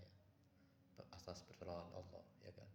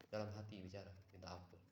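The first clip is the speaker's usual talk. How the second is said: normal talking voice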